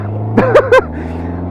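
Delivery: breathy high-pitched laugh, real amusement. Real, not acted. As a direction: burst into a squeaky laugh. Laughing at the kid's retort.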